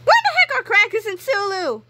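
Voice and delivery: high, loud frantic call; worried boy calling out; crying out. Acted. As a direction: cry out for the missing pets. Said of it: "cried George".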